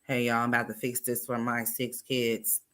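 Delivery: flat monotone imitation. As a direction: say it dead flat. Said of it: "she just got a monotone".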